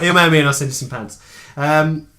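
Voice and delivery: ordinary talk, nothing marked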